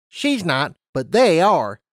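brisk scientist explaining rules; lab unicorn. Acted.

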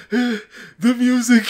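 high, loud, dragged-out wail; half laughing, half crying plea; desperate cry. Retold or imitated. Desperate relief mid-trip.

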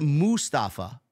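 "Mustafa" as MOO-stafa, rising at the end; questioned pronunciation. Shown as a demonstration. Host checking how the name is said, "Mustafa" as a rising MOO-stafa. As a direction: try out "Mustafa" with a long oo, questioningly. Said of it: "is that the way he said".

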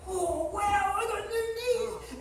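high, drawn-out mock wonder; amazed delight. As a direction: play thrilled, slow and high, with a laugh. Healed fisherman rejoices in story.

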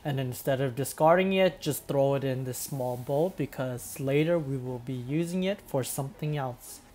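soft, quiet tutorial voiceover; calm voiceover narration. Acted. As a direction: narrate the steps calmly and quietly.

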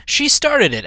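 ordinary delivery

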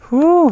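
big relieved exhale; loud whew. Real, not acted. Exhale at the bottom.

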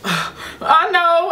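high, whiny mock sob; tearful teenager, acted out; imitated crying. Daughter answering angry dad, mock-tearful. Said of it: "I'm crying and snot running".

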